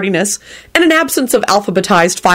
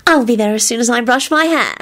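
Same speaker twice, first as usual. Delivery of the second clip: put-on mildly anxious, high girlfriend voice; 5 semitones higher than usual. A narrator's reading. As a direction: promise him worriedly, a little high. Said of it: "she said anxiously".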